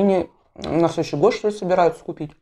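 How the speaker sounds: talking while biting bread; muffled by food at mouth; bread against lips. Real, not acted. Seen 0: Talks first, then bread pressed to lips; chewing not clearly seen.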